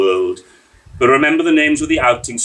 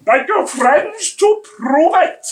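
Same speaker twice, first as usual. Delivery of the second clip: much higher, louder, slow furious insistence; in a fury; 7 dB louder, slower than usual. A narrator's reading. Stranger arguing with Enoch Davis, furious. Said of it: "the stranger said in a fury".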